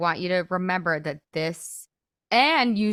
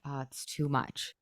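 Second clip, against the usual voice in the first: low, soft, resigned inner voice; discouraged self-talk; 4 semitones lower, 8 dB quieter than usual. Acted. Coach voices listeners' thought that it's too much, softly discouraged.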